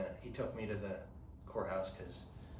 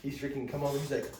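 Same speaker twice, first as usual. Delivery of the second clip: ordinary talk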